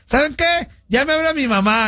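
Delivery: acting out his excuse. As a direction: announce it to your friends as a kid bailing out. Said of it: "dos veces en mi vida fue decir que".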